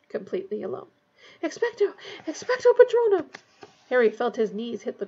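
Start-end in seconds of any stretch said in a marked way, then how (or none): 1.4-3.3 high cry building to a shout; desperate incantation. A narrator's reading. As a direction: start strained, then shout the spell. Harry alone, casting desperately.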